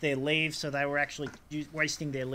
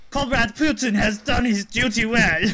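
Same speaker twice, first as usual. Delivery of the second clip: put-on loud, high, slow comic Russian accent; mock Russian accent; 7 semitones higher, 11 dB louder than usual. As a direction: proclaim it slowly in a hammed-up Russian accent. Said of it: "doing a Russian accent to a Russian".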